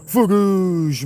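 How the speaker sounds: high, loud nonsense cry; excited squeal. Pretend. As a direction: yelp it out in a strange voice. Silly exclamation to chat.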